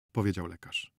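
ordinary delivery, nothing marked

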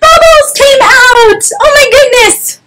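hammed-up storyteller shock; astonished reveal. A narrator's reading. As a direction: squeal it out in mock amazement.

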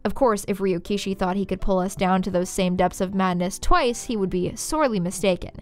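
ordinary delivery

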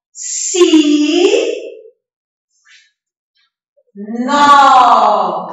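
si held long, pause, nó held long; separated syllables. Shown as a demonstration. Teacher splitting sino for children, si and nó drawn out separately. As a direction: stretch si and nó far apart. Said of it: "Quantas sílabas?".

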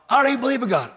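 high, indignant challenge; retold anger. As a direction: imitate a mad cop's retort. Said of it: "He's mad".

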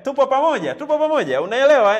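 loud, high check-in with learners; rallying the class. Real, not acted. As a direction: call out to keep everyone with you. Teacher checks class is following.

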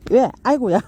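ordinary talk

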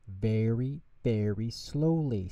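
slow, drawn-out "very, very slowly"; confident and slow. Acted. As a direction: stretch the words out with confidence. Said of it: "with great confidence".

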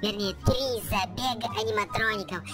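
usual speaking voice

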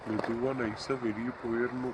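soft, low talk to oneself; furtive. Acted. Man sneaking out of the house, furtive. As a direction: keep it low so nobody catches you.

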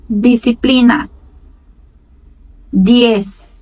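each Spanish word spoken slowly and loudly for repeating; slow clear Spanish. Shown as a demonstration. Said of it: "Eu pronuncio e vocês pronunciam depois".

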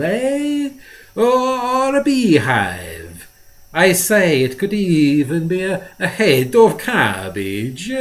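oily little man toying with an impatient collector; taunting greasy dealer. Acted. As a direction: dangle each guess to goad him. Marco's taunting voice held.

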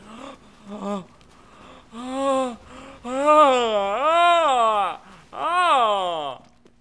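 loud high gasp of alarm; panicked gasp. Real, not acted. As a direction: suck in air in panic. Panicked chase in game.

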